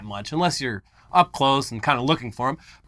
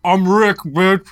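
raised, garbled imitation of mumbling; mock mumbling; 4 semitones higher, 5 dB louder, slower than usual. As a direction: slur the quote together in a higher voice.

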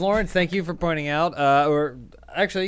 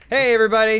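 loud greeting; 7 semitones higher, 7 dB louder, faster than usual. Real, not acted. Show greeting, called out.

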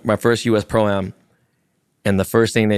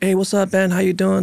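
high, sing-out imitation of a greeter; chirpy retold greeting; 9 semitones higher than usual. Tour truck guy greets Ben, high imitated greeting. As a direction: put on a high, friendly hello.

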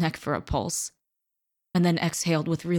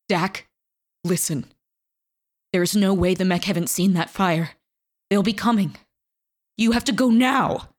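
urgent plea; 2 semitones higher, 5 dB louder than usual. A narrator's reading. Fox urging Dakota to flee, rising desperate plea. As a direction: make her leave before the mechs come.

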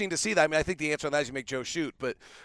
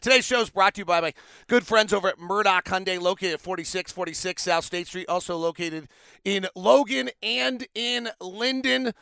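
upbeat sponsor read, a bit louder; 3 semitones higher, 5 dB louder than usual. Acted. Host reading a sponsor ad for listeners, upbeat. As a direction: sell it like a radio spot.

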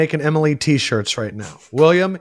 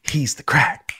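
announcer; 3 semitones lower, 5 dB quieter, slower than usual. Acted. Segment intro announcement.

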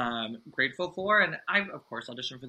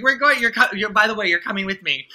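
retold thrilled news, much higher and louder; excited announcement; 9 semitones higher, 13 dB louder than usual. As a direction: blurt the big news, over the moon. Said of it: "And I was like".

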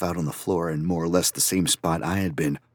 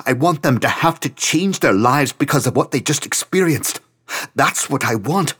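higher and louder, fervent; burn with ambition as you say it; an artist's feverish outcry; passionate rant; 7 semitones higher, 6 dB louder than usual. Acted. Franz passionate rant about art.

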